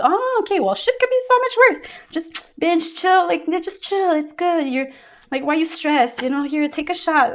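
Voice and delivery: tossing off advice like a breezy party friend; mock laid-back friend. Reader mimics a carefree friend telling someone to just chill, high and breezy.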